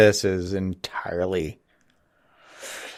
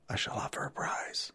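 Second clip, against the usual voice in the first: whisper; 17 dB quieter than usual. Retold or imitated. Reading a silly subtitle aloud.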